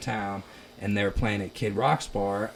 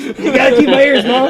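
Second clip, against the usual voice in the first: high, loud laughing plea to mom; 18 dB louder than usual. Retold or imitated. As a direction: beg mom through laughter.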